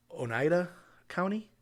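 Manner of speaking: hesitant attempt at a tricky place name; unsure pronunciation. Real, not acted. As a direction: tentatively try "Oneida", rising into a questioning "County". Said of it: "have a hard time pronouncing this".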